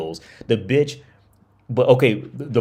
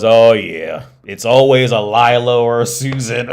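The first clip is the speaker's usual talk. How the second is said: put-on loud, fed-up old shopkeeper; mock exasperated old man, much louder; weary old man; 3 semitones higher, 9 dB louder than usual. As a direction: boom it out like he's heard it a million times. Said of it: "And he goes".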